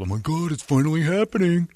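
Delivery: thrilled that it's happening at last, played up; hopeful excitement. Smitten boy's hopeful inner voice.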